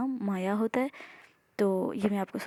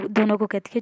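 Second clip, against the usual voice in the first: usual speaking voice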